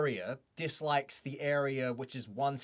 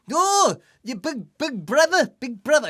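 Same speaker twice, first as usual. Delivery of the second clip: high, loud, slow mock New Zealand voice; bad Kiwi accent; 12 semitones higher, 12 dB louder, slower than usual. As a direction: ham up a Kiwi taunting big brother. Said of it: "I can't do a Kiwi accent properly".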